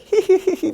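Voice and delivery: shrill little taunting laugh; mocking giggle. Old master pointing and laughing at him, taunting giggle. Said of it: "pointing and laughing at me".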